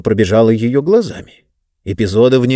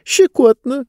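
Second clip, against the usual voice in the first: хихиканье; 14 semitones higher than usual. Acted. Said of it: "захихикала книга".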